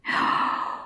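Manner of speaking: sharp breathy intake of air; a hushed little gasp; soft gasp. Acted. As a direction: gasp quietly with longing. Girl tempted by forbidden chest.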